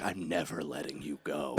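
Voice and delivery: retold threat, barely above a murmur; low quiet threat. Fighter to the man he's gripping, low quiet threat. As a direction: drop it low and soft, menacing.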